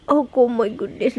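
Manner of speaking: ordinary talk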